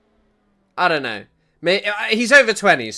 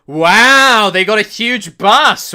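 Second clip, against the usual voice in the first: slow, loud sarcastic wow; hammed-up sarcastic awe; mock amazement; 4 semitones higher, 8 dB louder, much slower than usual. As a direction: fake impressed at the bus. Mocking a news clip claim.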